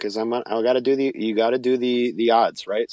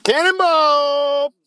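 long, drawn-out high shout; cannonball yell; 5 dB louder, much slower than usual. Pretend. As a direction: belt it out like jumping into a pond. Yelling cannonball after podcast sign-off.

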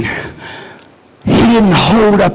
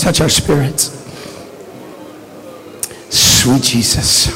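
low, soft prayer voice; leading prayer; 7 semitones lower, 4 dB quieter than usual. Acted. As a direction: pray with them, hushed. Leads prayer.

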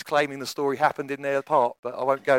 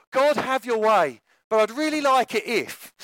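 mock prayer; 4 semitones higher than usual. Worshipper bargaining with God, mock pleading prayer. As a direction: pray piously, then angle for what you want. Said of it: "we have a way of praying".

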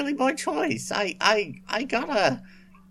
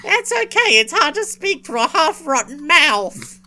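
zombie voice; 5 semitones higher, 6 dB louder than usual. Acted. Zombie character voice.

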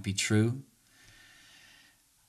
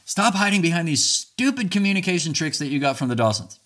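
higher, louder, hammed-up jab at a partner; mock angry accusation; 9 semitones higher, 6 dB louder than usual. Husband mock-voicing his wife's accusation, hammed-up and loud. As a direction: sneer it like a hurt spouse throwing an accusation. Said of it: "how's this one for a last one?".

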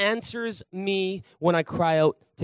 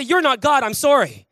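said to neighbor; 5 semitones higher, 7 dB louder than usual. Shown as a demonstration. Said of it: "Turn to your neighbor and say".

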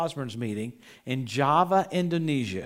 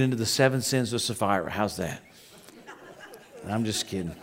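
joking; 6 semitones lower, 5 dB quieter than usual. Real, not acted. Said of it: "I joke about this".

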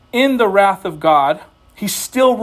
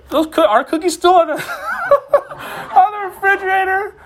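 breaking into laughter mid-question; laughing question; 12 semitones higher, 3 dB louder than usual. Retold or imitated. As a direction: ask about the cookie while cracking up. Wife asks about cookies, laughing.

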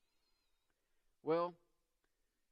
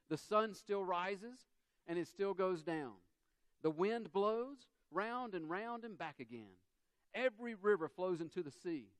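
scripture reading; 4 semitones higher, 3 dB louder than usual. Acted. Look at verses five through eight.

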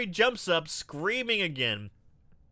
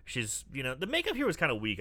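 ordinary talk, nothing marked

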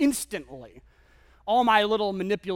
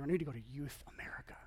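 whispered plea; 5 semitones lower, 13 dB quieter, faster than usual. Acted. Teen pleading to his dad.

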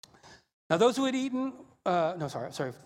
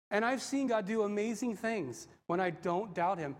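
ordinary delivery

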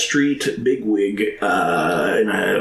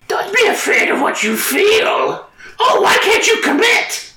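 mock shrill shout; 4 dB louder than usual. Quoting a gremlin, shrill.

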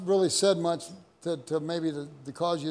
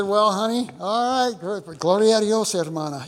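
louder, higher comic exclamation; loud mock surrender; 5 semitones higher, 8 dB louder than usual. Pastor outdone by his wife, louder comic concession. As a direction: throw up your hands and praise God loudly. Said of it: "And I said".

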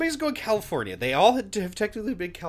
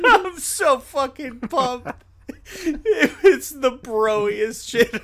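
loud, high, slow through laughs; laughing hard; 7 semitones higher, 8 dB louder, much slower than usual. Real, not acted. Co-host riffing on the bro talk, laughing hard. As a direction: push the words out between laughs. Still cracking up at the bit.

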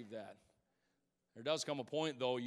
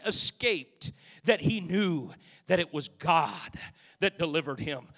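rising, loud, fast gospel preaching; whooping preacher building energy; 4 semitones higher, 8 dB louder than usual. Acted. Preacher building to full force.

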